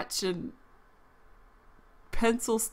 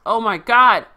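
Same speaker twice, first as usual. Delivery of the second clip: sudden loud startled cry; startled outcry; 3 semitones higher, 13 dB louder than usual. Real, not acted. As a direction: blurt it out in real shock. Character walks in, shocked.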